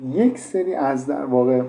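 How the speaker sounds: ordinary delivery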